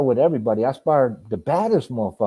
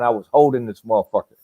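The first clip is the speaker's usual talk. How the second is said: usual speaking voice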